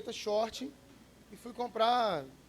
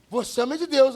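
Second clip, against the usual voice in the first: excited stranger; 5 semitones higher, 10 dB louder than usual. Retold or imitated. Man reaches the checkout.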